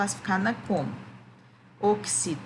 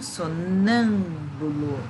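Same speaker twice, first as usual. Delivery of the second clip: Sonâmbulo with a long, heavy NÂM, so-NÂÂM-bulo; stress on NÂM; 2 semitones lower, much slower than usual. Shown as a demonstration. Teacher answering which syllable is tonic, exaggerated stress on NÂM. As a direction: stretch and punch the NÂM of Sonâmbulo. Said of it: "Qual é a sílaba tônica mesmo?".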